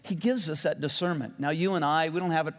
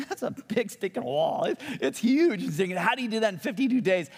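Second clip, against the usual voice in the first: high-pitched incredulous marvelling; astonished, voice jumping up; amazed; 7 semitones higher than usual. Real, not acted. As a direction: marvel at something impossibly huge. Marvelling at the size of the wall.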